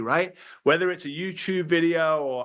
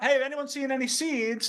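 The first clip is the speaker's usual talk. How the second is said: asking around; 10 semitones higher than usual. Pretend. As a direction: call out lightly as if searching. Acted quote asking around.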